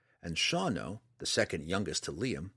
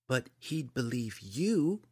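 slow, higher-pitched insistent retort; sharp reply; 5 semitones higher, slower than usual. A narrator's reading. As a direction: press the point, stretching it out. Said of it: "Liam replied sharply".

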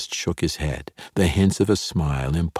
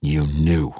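growl. A narrator's reading. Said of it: "Zaknafein said with a growl".

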